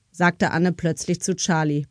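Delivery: ordinary delivery, nothing marked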